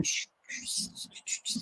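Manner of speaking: soft unvoiced hiss of spraying water; hissing pipe imitation. Imitating the broken pipe for viewers, soft hissing. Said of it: "it's going".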